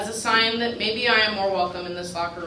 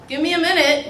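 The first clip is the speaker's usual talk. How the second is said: mock authority; 9 semitones higher, 8 dB louder than usual. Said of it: "with all the authority of a middle school kid".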